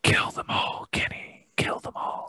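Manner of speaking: close-mic whisper; right in your ear. Pretend. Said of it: "get up close to the mic and whisper like this".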